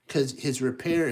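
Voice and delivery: plain reading voice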